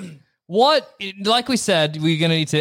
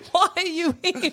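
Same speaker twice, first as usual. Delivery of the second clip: high comic questioning; nanny falsetto; 10 semitones higher than usual. Acted. Player quizzes the disguised nanny, in high falsetto. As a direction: ask it in a hammed-up high voice.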